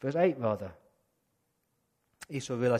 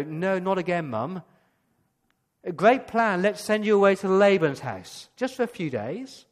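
sarcastic son; 3 semitones higher, 6 dB louder than usual. Retold or imitated.

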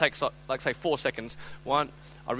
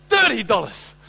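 louder, higher mock shock; hammed-up outrage, falling at the end; shocked indignation; 4 semitones higher, 6 dB louder, slower than usual. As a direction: exclaim in disbelief at the price. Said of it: "looking as shocked as you can".

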